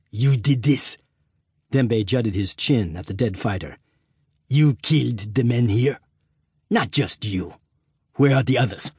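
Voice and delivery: a tense African fighter; accented fighter voice. Acted. Dembe confronts the American.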